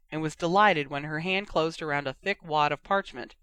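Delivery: usual speaking voice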